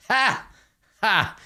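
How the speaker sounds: high, barked fake "ha, ha"; two short, high staged laughs; mocking laugh. Crime boss scoffing at the armed intruder, mocking laugh. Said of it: "he laughs. He's just like".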